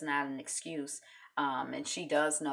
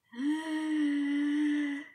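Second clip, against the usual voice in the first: gasp; 11 semitones higher, 4 dB louder than usual. Real, not acted. Stream disconnected panic.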